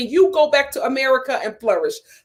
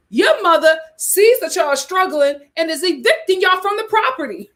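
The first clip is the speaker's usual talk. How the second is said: ordinary delivery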